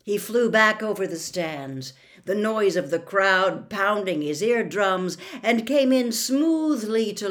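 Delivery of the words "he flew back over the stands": ordinary delivery, nothing marked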